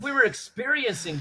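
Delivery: laughing. Real, not acted. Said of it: "And we were all laughing".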